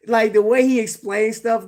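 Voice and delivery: ordinary talk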